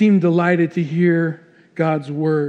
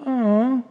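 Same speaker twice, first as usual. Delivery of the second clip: soft, higher-pitched "aw" imitating the wife; wife's sympathetic aw; 5 semitones higher, 3 dB quieter than usual. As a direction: coo a little aw of interest. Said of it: "Polly would do this".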